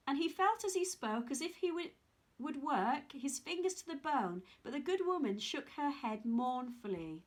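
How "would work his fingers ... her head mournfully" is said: ordinary delivery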